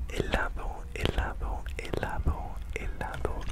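ordinary talk

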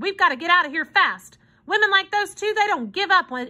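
high, urgent warning; urgent order. A narrator's reading. Hobo warns children to get out, urgently. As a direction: press them to move at once. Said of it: "he ordered".

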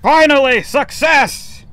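high, loud victory yell; triumphant cry. Real, not acted. Gamer celebrating a finished ghost hunt, loud victory cry. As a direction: shout the win to the room.

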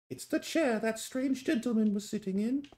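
high-pitched mock-British reading; British accent. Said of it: "I get to do my British accent".

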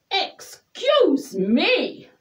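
high, slow indignant cry; shouted. A narrator's reading. Rabbit used as a hanky by Douglas, indignantly calling out. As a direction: swoop up in affronted protest. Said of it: "shouted the rabbit".

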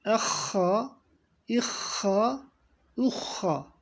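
Ehha with a rasping kh from the throat; throat-clearing kh. Shown as a demonstration. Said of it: "hı şeklinde yapacağız".

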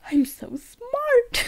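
high, slow squeal into laughter; mock yourself, about to burst out laughing; drawn-out, high-pitched sarcastic boast; self-mocking. Real, not acted. Mocking own misreading, laughing.

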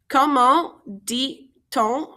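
"Comment dit-on" slowly, syllable by syllable; "Comment dit-on" spaced out for learners to follow; slow breakdown. Shown as a demonstration. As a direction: pause between each part of "Comment dit-on". Teacher breaks the phrase down slowly.

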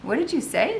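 high-pitched, sudden demand; fired question. A narrator's reading. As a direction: fire the question at a bystander. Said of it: "the captain fired at me".

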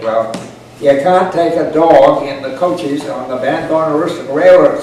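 ordinary delivery, nothing marked

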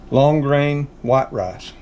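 ordinary talk, nothing marked

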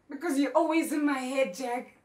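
upset shout; anguished outburst. Acted. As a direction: cry it out at him in exasperation. Acted anguished outburst at husband.

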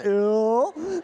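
loud, put-on goofy Ew; a loud, grossed-out country boy grunt; country boy dummy voice. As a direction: blurt it like a dopey puppet. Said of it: "no, I do the voice".

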